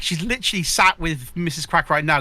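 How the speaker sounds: usual speaking voice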